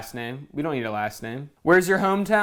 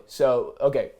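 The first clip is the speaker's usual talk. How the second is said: usual speaking voice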